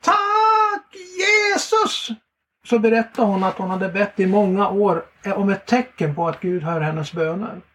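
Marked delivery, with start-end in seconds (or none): 0.0-2.1 high, very slow cry of thanks; woman cries out her thanks to Jesus. Retold or imitated. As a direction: shout it out to heaven, drawn out and high. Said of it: "hon skrek rakt ut".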